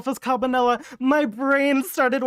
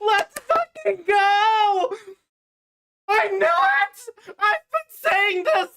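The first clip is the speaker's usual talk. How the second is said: triumphant shout; 6 semitones higher, slower than usual. Real, not acted. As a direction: roar in tearful triumph. Celebrating correct theory.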